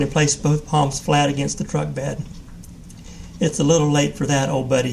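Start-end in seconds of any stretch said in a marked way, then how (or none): none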